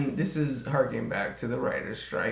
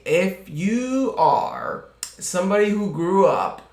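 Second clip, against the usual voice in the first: usual speaking voice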